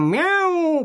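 long, very high cat yowl; piercing meow. A narrator's reading. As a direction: wail a piercing meow as you fall. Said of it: "с пронзительным". (пронзительное мяуканье)

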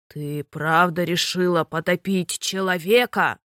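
low, slow stern father; a king holding back anger; stern sea king. A narrator's reading. Sea king scolds daughter. (низко и медленно, строгий царь-отец)

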